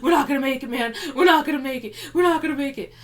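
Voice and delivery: high, loud panicked wailing; mock panic. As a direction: squeal it like he's losing it. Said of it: "he's the one panicking".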